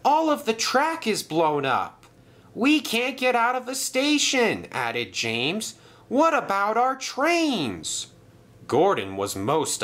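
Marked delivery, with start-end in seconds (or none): 0.1-4.7 high-pitched, panicky exclaiming; alarmed. A narrator's reading. Engines react to the blast. 6.1-8.1 high, drawn-out worried question; dismayed. A narrator's reading. Engine dismayed by blown track.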